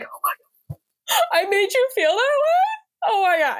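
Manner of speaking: high, tearful mock sob of gratitude; reading a client's review, choked up and high; teary gush. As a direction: gush like the review made you cry. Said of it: "I read some of them sometimes and I'm like".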